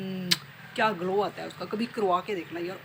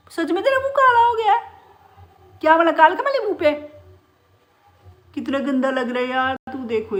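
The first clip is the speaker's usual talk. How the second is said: high, loud, amazed pet talk; loud and high, fussing over the bird; shocked scolding of pet; 9 semitones higher, 12 dB louder than usual. Real, not acted. Owner shocked at the parrot's blackened face, high loud fussing.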